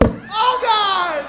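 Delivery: loud, high wail of dread; terrified cry. Retold or imitated. Kid hitting the no gravity zone on the ride, terrified cry. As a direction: holler it as you lose your grip.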